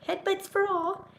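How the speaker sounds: high playful coo. Real, not acted. Offering headbutts to her cats in a high coo. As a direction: squeak it out to the pets.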